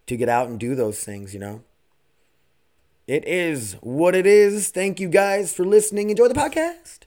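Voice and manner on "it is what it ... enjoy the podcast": hyped sign-off. Acted. Signing off to listeners, loud and high. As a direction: pump them up on the way out.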